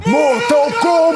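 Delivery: title shouted out loud. Retold or imitated. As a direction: call out the title like a trailer. Anuncia o título em grito. (título gritado)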